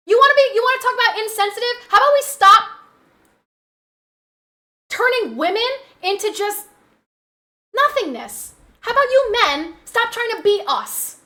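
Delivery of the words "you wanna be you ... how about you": angry outburst. Real, not acted. Angry rant continues.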